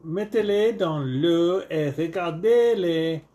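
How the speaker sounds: reading the box aloud in a lightly put-on French accent; a hint of French, read like a label. Dad reading toy instructions to kids in mild French accent. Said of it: "Get my French accent".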